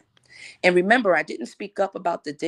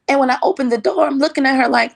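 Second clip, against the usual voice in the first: loud build-up; 11 semitones higher, 8 dB louder, faster than usual. Real, not acted. Opening the door, annoyed.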